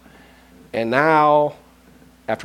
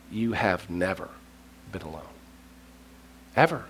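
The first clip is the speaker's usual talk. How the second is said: hushed reassurance; 6 semitones lower, 10 dB quieter than usual. Acted. God's voice comforting Elijah.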